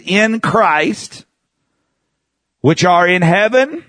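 slow, drawn-out, rising reading; lightly heightened Bible reading; scripture reading. Acted. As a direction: read each phrase with weight. Reads scripture aloud.

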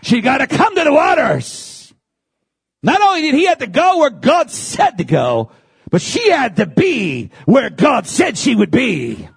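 high, shouted sermon climax; full-force preaching. Real, not acted. Preacher insisting to congregation on the bride's calling, shouted preaching.

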